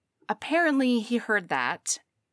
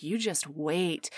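low teasing murmur; 6 semitones lower, 6 dB quieter, slower than usual. Retold or imitated. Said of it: "and he's like".

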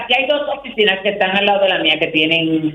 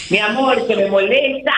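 ordinary talk, nothing marked

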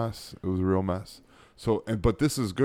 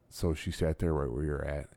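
usual speaking voice

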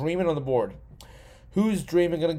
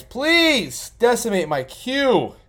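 drawn-out, higher, louder fake begging; mock pleading; 8 semitones higher, 5 dB louder, much slower than usual. Streamer begs the other drafters, slow and hammed-up. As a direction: stretch the plea out like a mock beggar.

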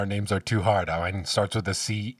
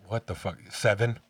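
usual speaking voice

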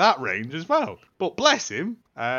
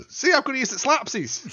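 higher, playful mock suggestion; high joking voice; 10 semitones higher than usual. Joking with friends about the film, a playful high voice. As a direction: tease like a kid dreaming up a game.

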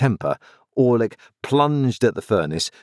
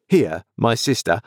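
ordinary delivery

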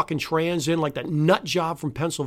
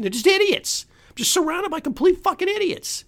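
fed-up cry of disgust, pitched high; exasperated outburst; 13 semitones higher than usual. Real, not acted. Ranting outburst about idiots.

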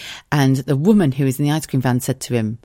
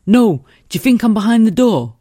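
raised, insistent repeat; exasperated husband; 8 semitones higher, 6 dB louder than usual. Retold or imitated. Husband repeating himself to a confused wife, exasperated. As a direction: push the phrase again, impatient. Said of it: "He went".

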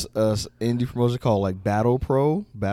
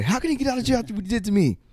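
high, fast indignant whine; hammed-up indignation; outraged protest; 10 semitones higher, much faster than usual. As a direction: protest in mock disbelief, voice shooting up. Acting Miz's outraged complaint.

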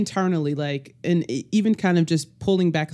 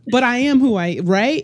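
ordinary talk

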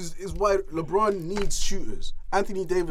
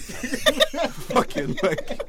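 high-pitched helpless laughter breaking up the words; too tickled to finish the sentence; cracking up; 4 dB louder, much slower than usual. Real, not acted. Ranting about Russ to friends, cracking up.

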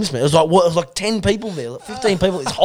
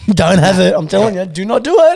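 mock warning; 6 semitones higher, 9 dB louder than usual. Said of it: "he's like".

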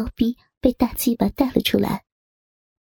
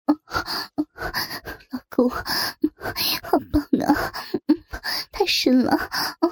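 moaning woman; 4 semitones higher than usual. Acted. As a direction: gasp the words out in pleasure.

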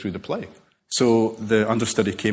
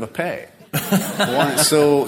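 amused, laughter breaking into the words; laughing; 6 semitones higher, 5 dB louder, much slower than usual. Real, not acted. Author joking about pets in crime fiction, laughing for real. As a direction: let the laugh carry the line.

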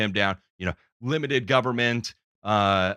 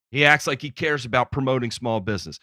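ordinary talk

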